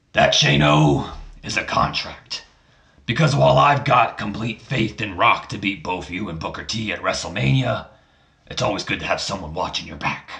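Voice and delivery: Vince McMahon voice. Retold or imitated. Vince McMahon speaks after needing drink.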